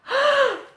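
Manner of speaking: a big surprised gasp, pitched high; sharp high gasp. A narrator's reading. Reading to a child, a high gasp of surprise. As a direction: gasp in mock shock before the reveal.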